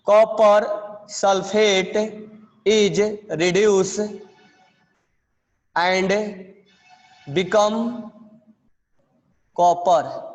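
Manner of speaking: slow, separated dictation words; steady classroom dictation. Shown as a demonstration. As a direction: pause between phrases for writing. Dictating the reaction summary.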